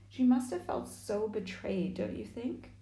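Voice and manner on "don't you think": usual speaking voice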